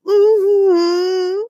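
high crying whimpered mm-hmm; teary mm-hmm. Retold or imitated. Cohost imitates Brittany's teary yes, high whimper. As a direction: whimper it through tears. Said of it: "Brittany's like".

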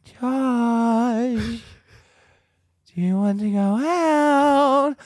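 high, slow eerie voice; scare-house actor whispering into Josh's ear; creepy whisper in ear. Retold or imitated. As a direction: tease a scared guest. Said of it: "someone in my ear say".

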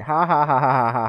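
loud, deliberate ha-ha cackle; mock villain laugh. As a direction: imitate an evil caller's gloating laugh. Imitated villain laugh on phone.

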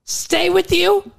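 pushing him away; acting Allie. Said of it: "it was The Notebook, the infamous scene".

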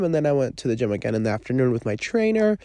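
usual speaking voice

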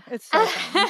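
gushing "so fun" up high; giddy high-voiced "It's so fun"; squealy gush. Shown as a demonstration.

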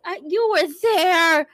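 high, loud anguished protest; betrayed and heartbroken. Real, not acted. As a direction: cry out in betrayal. Player betrayed by teammate.

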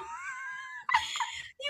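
high wailing laugh; shrieking laugh. Real, not acted. Laughing about being a hot mess. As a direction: squeal with laughter.

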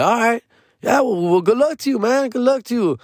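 high, sing-song goodbye to the singer; mock polite goodbye. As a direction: wish him luck with fake politeness.

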